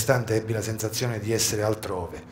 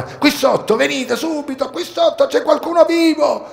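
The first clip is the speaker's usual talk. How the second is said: much higher, loud cry to the rescuers; urgent shout; 14 semitones higher, 6 dB louder than usual. A narrator's reading. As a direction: call out across the noise of the ruins. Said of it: "gridai rivolto a Fernandi e Piccioni".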